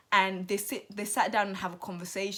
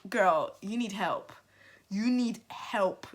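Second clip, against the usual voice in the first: slow deliberate scolding; slow emphatic telling-off; slower than usual. Real, not acted. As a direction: spell it out slowly and firmly.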